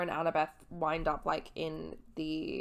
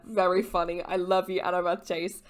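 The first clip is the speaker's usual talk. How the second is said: usual speaking voice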